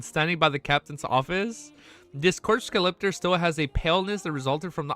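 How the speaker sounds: ordinary talk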